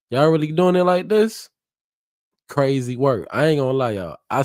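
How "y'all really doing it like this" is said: high, loud disbelieving question; can't believe what he's hearing; incredulous. Real, not acted. Incredulous reaction to the couple.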